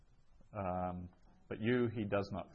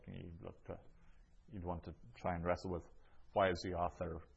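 usual speaking voice

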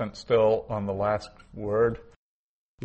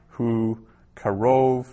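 ordinary talk, nothing marked

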